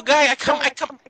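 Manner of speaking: ordinary talk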